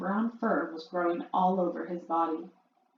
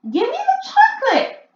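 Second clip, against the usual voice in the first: much higher, loud suspicious shout; 11 dB louder than usual. A narrator's reading. Bruno shouting at the witch, suddenly suspicious. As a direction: bellow it, suddenly wary. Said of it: "shouted Bruno".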